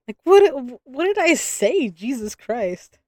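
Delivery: high, slow, bewildered groan; exasperated disbelief. Real, not acted. Reader baffled by a chat message, exasperated and drawn out. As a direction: throw up your hands at the confusion.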